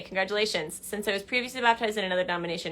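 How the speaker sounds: ordinary delivery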